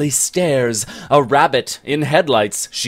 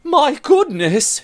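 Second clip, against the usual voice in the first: very high, louder shocked gasp of words; 5 dB louder than usual. Acted. Bennet shocked at the giant's greed: high appalled exclamation. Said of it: "she says".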